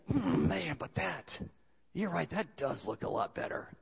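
soft, low pondering to oneself; tempted musing. Retold or imitated. Eve eyeing the forbidden fruit, voiced in soft musing. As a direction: muse quietly as temptation wins.

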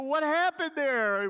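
slow, loud, high bafflement; bewildered question. Retold or imitated. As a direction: wake up stunned at your own anger. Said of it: "you go".

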